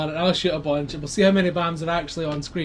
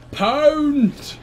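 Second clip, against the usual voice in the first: loud high battle cry; attack shout; 10 semitones higher, 7 dB louder than usual. Pretend. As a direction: slam it out like a bear attack.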